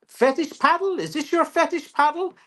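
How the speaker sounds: high, loud mock blurt of the clerk; hammed-up shocked clerk, shouting it across the queue; loud retold clerk. Post office clerk reading the label aloud, loud high mock blurt. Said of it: "she said it so loud".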